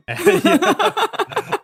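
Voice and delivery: yeah through a burst of laughter; laughing. Real, not acted. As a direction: laugh it out high.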